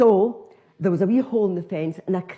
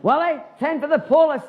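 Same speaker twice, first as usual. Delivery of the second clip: high, louder outraged woman; angry housewife; 9 semitones higher, 4 dB louder than usual. Retold or imitated. Lady of the house catching an intruder in her garden, high outraged call. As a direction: shriek for the husband like an outraged housewife.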